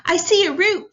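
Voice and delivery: loud, high call to friends below; eager call from above. Acted. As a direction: shout it down the cliff. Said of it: "Andy called down to his friends".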